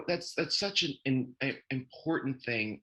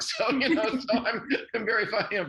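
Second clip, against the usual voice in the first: laughing; 12 semitones higher, 6 dB louder than usual. Real, not acted. Laughing about living with dog.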